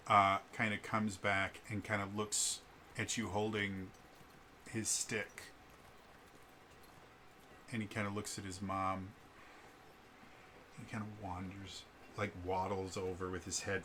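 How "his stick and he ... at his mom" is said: ordinary talk, nothing marked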